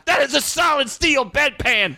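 wrestling commentator. Pretend. Commentator bit.

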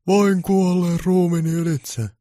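high, slow, loud dramatic bellow; bellowing Santa. Acted. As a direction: roar it like a last stand. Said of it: "pukki mylvi".

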